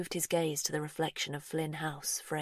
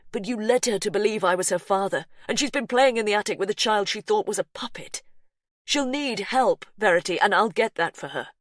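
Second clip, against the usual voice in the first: louder, pressing plea; Hugh, urgent; 4 semitones higher, 7 dB louder than usual. A narrator's reading. Hugh urging Verity to get Faith help, pressing. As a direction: push her to accept help.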